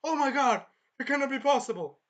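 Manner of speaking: high, loud hammed-up disbelief; shocked king. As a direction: cry out like an aghast king. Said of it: "The king was like this".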